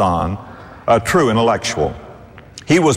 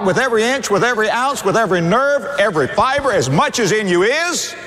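raised, pressing preacher's climax; impassioned preaching; 8 semitones higher than usual. Acted. Preacher pleading with the congregation to surrender, rising fervour. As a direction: build and press the congregation hard.

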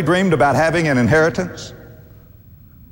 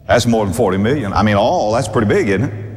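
ordinary talk, nothing marked

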